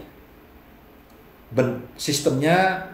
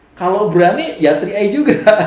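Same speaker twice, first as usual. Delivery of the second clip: higher, louder confident pitch; bold company pitch; 7 semitones higher, 7 dB louder than usual. Retold or imitated. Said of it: "Three I ngomongnya".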